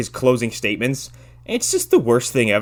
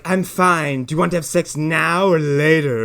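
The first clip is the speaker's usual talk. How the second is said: lightly voiced woman, pitched higher; imitated woman; 4 semitones higher, 4 dB louder than usual. Co-host mocking the crashed woman's come-on, higher imitated voice. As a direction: put on a higher voice for her. Voicing the woman's blunt proposition.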